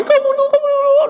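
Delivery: very high, tearful scolding through sobs; half scold, half sob at the found child; crying mother. Acted. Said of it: "nangis, Bu". (ibu menangis sambil memarahi, suara sangat tinggi)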